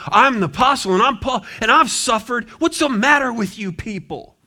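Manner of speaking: mock superior scolding; mock proud apostle. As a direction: puff up like a self-important apostle scolding the crowd. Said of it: "He doesn't show up saying".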